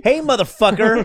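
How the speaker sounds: loud high hammed-up rapper voice with a Southern twang; mock rapper greeting.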